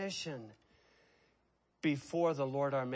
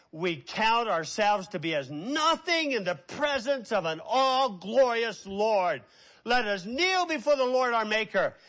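louder and higher as the quote climbs; rising preaching; 7 semitones higher, 6 dB louder than usual. Acted. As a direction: build the quote to a ringing close.

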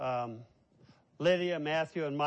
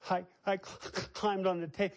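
higher, stammering through sobs; imitated sobbing child; 4 semitones higher than usual. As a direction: mimic a child confessing between gasps. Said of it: "she's crying".

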